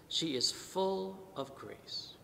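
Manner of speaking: "full" drawn out slowly and softly; stress on full. Shown as a demonstration. Priest repeating the angel's words, slow soft stress on "full". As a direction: linger gently on "full". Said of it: "Emphasis on the word full".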